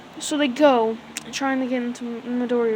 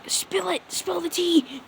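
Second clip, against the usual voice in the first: high mock-eager urging; eager gossip; 7 semitones higher, slower than usual. Classmates begging acid girl for news, high and eager. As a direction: beg for the gossip in a raised voice.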